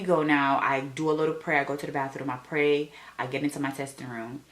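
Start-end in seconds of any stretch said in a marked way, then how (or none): none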